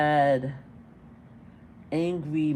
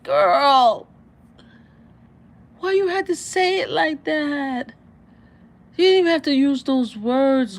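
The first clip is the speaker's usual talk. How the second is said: exasperated scolding; 7 semitones higher, 5 dB louder than usual. Real, not acted. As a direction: scold her, pitch up and loud. Scolding the speaker in the clip.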